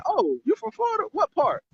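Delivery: light up like you just found a hometown friend; high, thrilled "what part?"; excited surprise. Pretend. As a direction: voice jumps way up, eager and delighted. Excited reply to a fellow Floridian.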